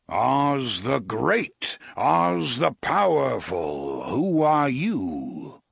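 slow, loud and grand; booming wizard. Acted. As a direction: proclaim it like a great wizard. Oz announces himself.

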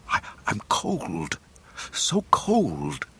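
slow, shivering whisper; freezing whisper. Acted. Frozen Luke answering Ben, whispered. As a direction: barely get the words out.

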